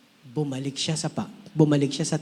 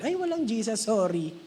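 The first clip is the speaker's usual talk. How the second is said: high playful quote of the Lord; mock Lord voice; 5 semitones higher than usual. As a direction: lightly voice God shrugging it off. (magaan na boses ng Panginoon na nagbibiro)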